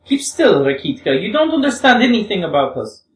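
a hint of a young woman, earnest and pleading; lightly voiced Grushenka. Grushenka voice again.

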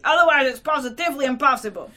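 loud, exasperated protest; seething. A narrator's reading. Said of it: "had begun seething terribly".

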